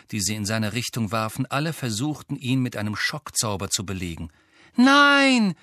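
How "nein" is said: high, loud cry of "no"; scream. A narrator's reading. Hermine cries out as Hagrid is attacked, screaming. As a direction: scream it out in protest. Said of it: "schrie Hermine".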